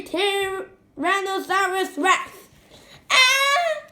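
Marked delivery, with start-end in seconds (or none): 3.1-3.9 loud high-pitched shriek; high scream. A narrator's reading. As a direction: shriek in fright. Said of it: "screamed Peter".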